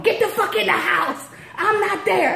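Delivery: high loud yell, voiced as husband. Husband on phone ordering her inside, shouting.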